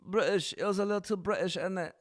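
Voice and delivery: lightly put-on British accent; mock British accent. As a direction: toss off a jokey British innit. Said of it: "The British accent".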